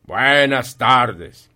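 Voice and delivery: loud, very stretched-out goodbye; emphatic dismissal. Acted. Scrooge refuses to hear his nephew, loud slow dismissal. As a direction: drag out each word loudly to cut him off.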